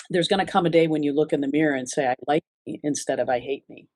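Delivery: usual speaking voice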